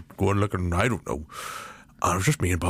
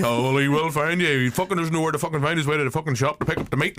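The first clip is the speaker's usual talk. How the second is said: imitating Marty; 6 semitones higher, faster than usual. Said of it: "That sounded awful like you there".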